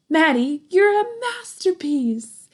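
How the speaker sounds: high, loud and slow in delight; proud dad. Acted. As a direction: marvel at it out loud. Dad proudly admires his son.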